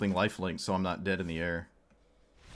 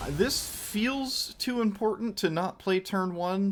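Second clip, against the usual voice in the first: slow, much higher mock Scottish brogue; over-the-top Scottish lilt; Scottish accent; 10 semitones higher, slower than usual. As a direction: ham up a Scottish accent, drawn out. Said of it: "That was an excellent Scottish accent".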